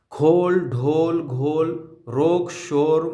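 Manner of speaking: slow teacher demo of खोल and ढोल with a clear ओ; o vowel demo. Shown as a demonstration. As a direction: stretch each word so the ओ is heard. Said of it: "वाव का तलफ़ुज़ ओ की आवाज़ में". (ओ की आवाज़ में धीरे-धीरे)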